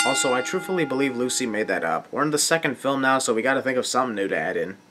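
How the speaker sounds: ordinary delivery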